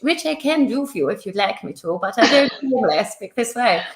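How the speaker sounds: put-on southern Queen's English accent. Shown as a demonstration. Author showing off the southern English accent, mock Queen's English. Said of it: "southern kind of Queen's English".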